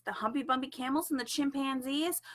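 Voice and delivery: ordinary delivery, nothing marked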